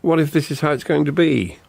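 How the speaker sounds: frightened what-ifs; anxious. Acted. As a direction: voice climbing with dread. Father fearing wife won't return.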